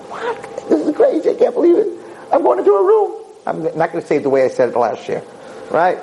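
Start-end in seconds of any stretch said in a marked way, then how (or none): 0.1-3.2 very high squeaky mock excitement; giddy disbelief. Guy invited into her room, squealing in giddy disbelief. Man thrilled at being invited in.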